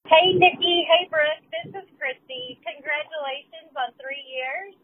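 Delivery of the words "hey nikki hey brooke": big bright loud hello to both hosts; excited loud greeting. Real, not acted. Caller greeting the podcast hosts, excitedly loud. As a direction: call out their names with real excitement.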